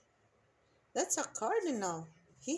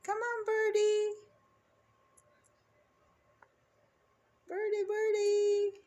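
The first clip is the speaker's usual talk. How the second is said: calling out; 8 semitones higher, 4 dB louder than usual. Real, not acted. Calling a bird to the feeder.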